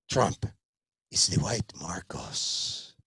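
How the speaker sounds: low, slow whisper. Acted. Father confides to son, low slow whisper. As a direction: whisper the punchline darkly.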